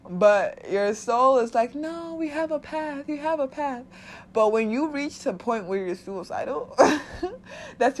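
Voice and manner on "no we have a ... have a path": much higher, slow and airy; soul's voice. Pretend. The soul reassuring the suicidal body, high and slow. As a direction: float it up high like a patient inner guide.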